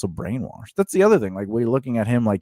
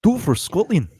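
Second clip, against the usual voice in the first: higher put-on Scottish accent; rally them in a Scottish accent; Scottish movie hero; 8 semitones higher, 4 dB louder than usual. Quoting Braveheart to a friend, mock Scottish accent.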